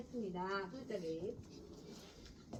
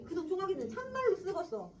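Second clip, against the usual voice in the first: put-on higher old man's praise in dialect; proud grandfather; 7 semitones higher than usual. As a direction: brag about the favorite grandson.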